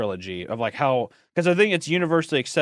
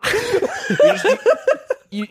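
ordinary talk

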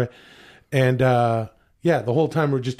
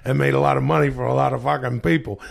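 New York guy; 2 semitones higher, faster than usual. Pretend. Actor doing his New York guy bit for the podcast. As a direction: talk it up like a Brooklyn wiseguy. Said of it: "This is me doing a New York guy".

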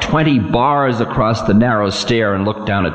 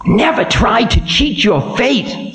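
high, ominous voice of Time; stern ancient Time; 12 semitones higher than usual. Acted. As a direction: warn the demon of doom.

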